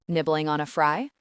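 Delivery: plain reading voice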